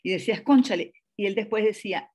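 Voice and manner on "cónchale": dismayed exclamation. Retold or imitated. Realizing she had done wrong.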